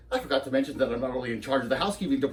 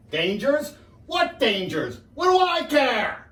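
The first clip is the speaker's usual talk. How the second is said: higher, building to a loud drawn-out cry; defiant outburst; 11 semitones higher, 3 dB louder, much slower than usual. Acted. Lecturer scoffing at dangers of tobacco, defiant outburst. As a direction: blow up, stretching the last words.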